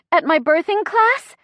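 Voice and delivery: high, loud questioning fragment; pained. A narrator's reading. Chrissy mid-contraction vents to the driver, pained. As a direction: push it up and out before the moan. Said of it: "Small moan".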